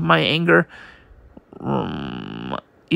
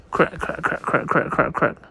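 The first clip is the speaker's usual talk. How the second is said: cracking sounds; 6 dB quieter than usual. Acted.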